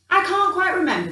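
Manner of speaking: stalling, can't quite recall; vague Gruffalo dad. A narrator's reading. Father Gruffalo dodging his child's question, hesitant. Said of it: "the Gruffalo said".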